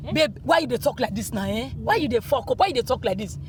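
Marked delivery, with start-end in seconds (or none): none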